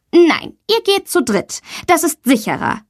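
a touch of firm classroom authority; firm teacher. A narrator's reading. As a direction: lay down the rule as the teacher. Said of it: "bestimmt ihre Lehrerin".

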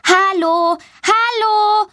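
put-on high, carrying child's call for help; calling out loud. A narrator's reading. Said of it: "ruft sie laut". (laut rufend, hoch und hallend)

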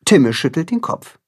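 usual speaking voice

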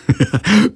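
high acted giggle; mocking laugh. A narrator's reading. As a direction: burst into a teasing laugh. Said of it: "hält sich den Bauch vor Lachen". (hohes, albernes Auslachen)

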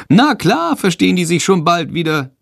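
high, bright bat voice reassuring; cheerful bat. A narrator's reading. Little bat Sándor reassuring his friend, cheerful. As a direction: chirp out a confident reassurance. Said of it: "sagt Sándor".

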